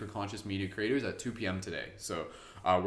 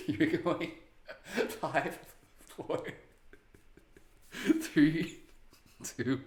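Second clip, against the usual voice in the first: high, giggly countdown; laughing while speaking; 5 semitones higher, much slower than usual. Real, not acted. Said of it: "you're making me laugh".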